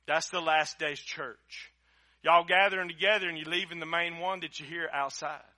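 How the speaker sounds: ordinary talk, nothing marked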